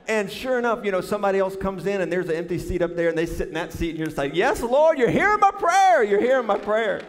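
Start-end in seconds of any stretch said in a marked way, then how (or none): 4.3-7.0 high, loud hallelujah-style shout; hammed-up cry of answered prayer; jubilant praying. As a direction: shout it in mock triumph. Acted triumphant answer to prayer.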